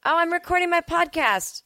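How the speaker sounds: high, lilting call to another room; high-pitched calling out. Real, not acted. Podcaster telling arriving parents she's recording, high-pitched calling out. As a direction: call out high to whoever just walked in.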